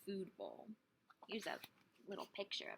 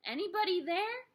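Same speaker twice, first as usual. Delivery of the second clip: higher, louder call into the dark; nervous call; 9 semitones higher, 5 dB louder than usual. A narrator's reading. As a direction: call out, unsure who's there. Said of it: "she asked".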